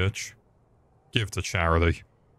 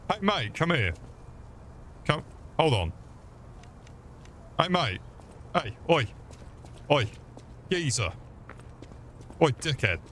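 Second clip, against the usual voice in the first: higher-pitched falling calls for attention; calling out; 5 semitones higher, 6 dB quieter than usual. Pretend. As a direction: hail someone cheekily, pitch jumping up then dropping. Calling out to game figure.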